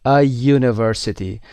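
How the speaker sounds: A university said slowly, the A as a plain uh before yoo. Shown as a demonstration. Teacher slowly models the article for students.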